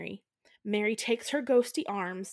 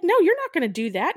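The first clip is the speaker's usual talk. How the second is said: higher and louder, a mock stern no; hammed-up stop-right-there yell; mock stern shout; 8 semitones higher, 7 dB louder, faster than usual. Sharon stopping Martin, a mock stern shout. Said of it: "She's like".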